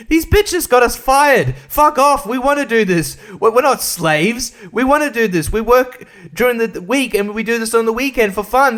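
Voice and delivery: high-pitched outraged rant, acted out; indignant complaining. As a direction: complain loudly as the wronged Grid Girls. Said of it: "like, complaining. They were saying".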